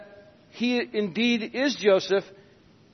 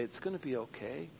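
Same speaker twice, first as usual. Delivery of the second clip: much softer and lower, gentle comfort; tender comfort; 6 semitones lower, 18 dB quieter, faster than usual. Retold or imitated. Parent comforting a child with a skinned knee, very softly. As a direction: soothe a crying child with it. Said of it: "That's the kind of tenderness".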